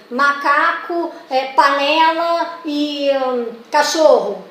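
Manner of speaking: drawn-out answer options; slow listing of choices. Shown as a demonstration. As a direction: read each option out slowly. Slowly reads answer options.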